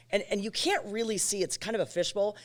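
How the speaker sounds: ordinary delivery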